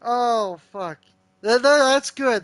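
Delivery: loud, excited burst of laughter-tinged praise; real delight. Real, not acted. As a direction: shout out genuine amazement. Delighted by AI's answer.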